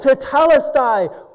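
high, loud yell of Tetelestai; yelled in victory. Acted. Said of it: "Jesus yelled".

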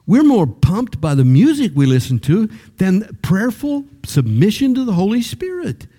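high, ringing preacher's cry; preaching climax. Real, not acted. Pastor crying out to the church, high and ringing.

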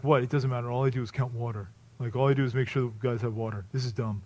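put-on a sulky water boy who shrugs it off; bored complaint.

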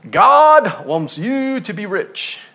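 mocking televangelist boast; mock preacher proclamation. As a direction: stretch out "God wants you to be rich" like a showman preacher. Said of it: "they're just coming right out and saying".

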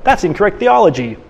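a touch of schoolmasterly correction; pastor-teacher mode. Retold or imitated. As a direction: play the prim theology teacher. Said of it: "Luther goes in that pastor-teacher mode".

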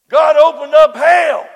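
thundering preacher's shout; preaching. Acted. Preacher to congregation, shouting at full force. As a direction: preach it out to the rafters.

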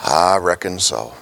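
a hint of a grieving farmer giving in; heartbroken Southerner. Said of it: "with a broken heart, that Southerner utters these words".

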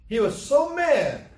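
SO MAD drawn out, high and loud; slow, high, loud punch on "so mad"; emphatic anger. Real, not acted. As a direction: stretch "so mad" with force.

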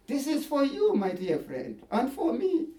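ordinary delivery

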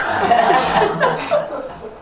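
high-pitched trailing laugh. Real, not acted. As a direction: laugh at your own joke. Laughs at his own joke.

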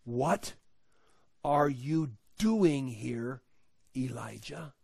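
slow, soft, spaced-out question; gentle whisper of God. Acted. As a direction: ask it gently, leaving room between the words. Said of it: "came a gentle whisper".

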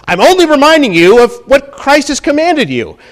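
high, loud, ringing proclamation; full-force preaching. Acted. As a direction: hammer it out to the congregation.

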